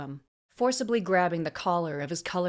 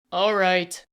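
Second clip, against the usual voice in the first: loud, firm interruption; calling out; 8 dB louder than usual. A narrator's reading. Dragonkin calling from the door, firmly. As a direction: call it across to stop them. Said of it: "Tezeth called from the door".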